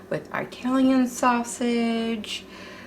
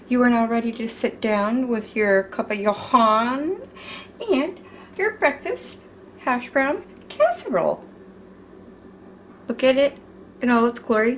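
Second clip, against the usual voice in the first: ordinary talk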